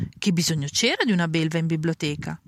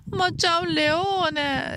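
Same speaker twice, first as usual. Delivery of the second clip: high, very slow alarmed protest; 11 semitones higher, much slower than usual. A narrator's reading. Assistant telling the librarian about the lion, high alarmed cry. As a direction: blurt it out, high and drawn out.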